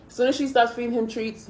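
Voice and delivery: normal talking voice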